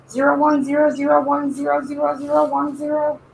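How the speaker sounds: high robot reading binary. Pretend. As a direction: chirp the digits like a robot. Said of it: "hear him talking to us".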